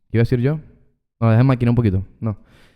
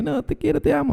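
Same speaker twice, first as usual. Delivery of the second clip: put-on drunk friend's plea; 14 semitones higher, 6 dB quieter than usual. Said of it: "y diga".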